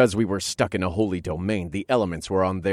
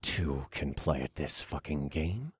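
growling; 5 semitones lower, 9 dB quieter, slower than usual. Acted. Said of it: "I growled".

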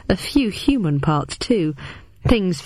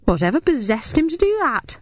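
high, disbelieving question; incredulous; 8 semitones higher than usual. A narrator's reading. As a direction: ask it in disbelief. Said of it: "I repeated incredulously".

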